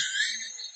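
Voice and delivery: breathless squeal of laughter; high laughing shriek. Real, not acted. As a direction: burst into an airless laugh. Laughing at rival live.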